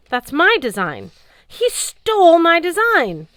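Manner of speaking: outraged at the stolen design. Acted. Dwarf Blitzen outraged that Cert stole his design.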